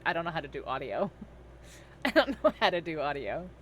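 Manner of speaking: ordinary delivery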